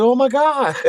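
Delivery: high squeal of mock alarm; retold shock. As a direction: blurt it out as if startled all over again. Retold shock at pond object.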